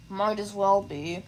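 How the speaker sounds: put-on low, slow sneering boy; lightly voiced sneering bully. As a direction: growl it out dismissively.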